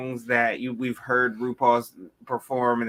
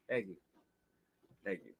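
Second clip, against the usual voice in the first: ordinary delivery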